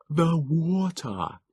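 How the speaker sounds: slow, high, breathless creature voice; exhausted Morlock urging toward the sea; wheezing Morlock. A narrator's reading. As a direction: gasp it out between breaths. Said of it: "His wheezing had grown so pronounced".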